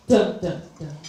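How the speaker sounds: low, loud ominous dun dun dun; dramatic dun dun dun. Acted. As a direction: sing dun dun dun like a suspense sting. Mock dramatic sound effect.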